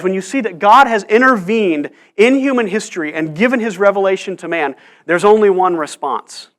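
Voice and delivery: ordinary talk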